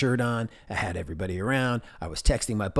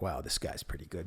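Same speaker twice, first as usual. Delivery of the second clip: 1 mock listener aside; 5 semitones lower, 11 dB quieter than usual. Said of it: "You're listening to this right now like".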